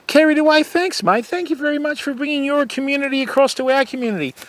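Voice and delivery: high, bright thanks to the raider; excited thanks. Real, not acted. Thanking Carried Away for bringing his community, high and excited. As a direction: welcome them in high and eager.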